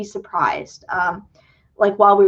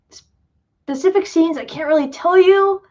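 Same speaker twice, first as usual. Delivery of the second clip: ordinary talk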